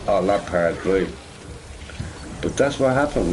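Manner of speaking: ordinary talk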